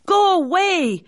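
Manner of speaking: high, panicked shout to go away; frightened cry. A narrator's reading. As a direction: cry out in fear. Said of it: "he cried".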